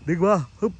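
strained shout while heaving; effort grunt. Real, not acted. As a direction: heave hard and grunt. Pulling something heavy.

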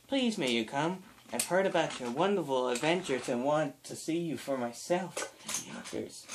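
soft, gentle letter-reading voice; little girl's letter. Acted. Little girl invites Thomas and friends, softly voiced. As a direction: read it softly, with small hesitations.

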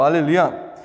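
ordinary delivery

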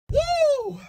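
high, loud whoop; piercing pumped-up cheer; excited whoop. Acted. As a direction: let out a hyped-up, high woo. Hooper arriving at the court.

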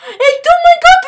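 high, loud, thrilled outburst; excited squeal. Real, not acted. Kid sees the gem fall, excited squeal. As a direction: shriek with delight.

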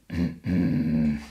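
low held rattling cough. Shown as a demonstration. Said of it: "try to make it, like, more rattly".

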